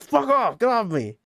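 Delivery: high, loud kick-free shout; mock yell. Boy yanking his leg free and shouting, high. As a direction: throw it off with the kick.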